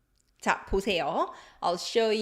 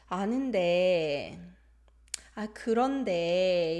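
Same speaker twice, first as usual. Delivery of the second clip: hesitant, unfinished-sounding endings on 데; hesitation; 2 semitones lower than usual. Shown as a demonstration. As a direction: stretch 데 as if holding back a but.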